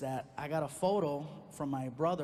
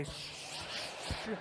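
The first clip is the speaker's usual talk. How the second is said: hushing; 7 dB quieter, much slower than usual. Retold or imitated. Comforting girlfriend.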